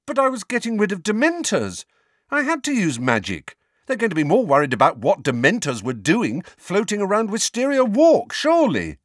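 put-on high, insistent young hero; protesting Harry. Boy defending his use of magic, protesting.

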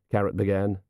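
usual speaking voice

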